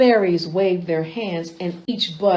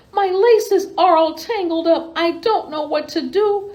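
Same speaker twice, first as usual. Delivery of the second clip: higher, lightly worried little voice; a hint of the fretting butterfly; 6 semitones higher than usual. Butterfly tells Betty about its tangled laces, lightly fretting. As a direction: plead a bit helplessly.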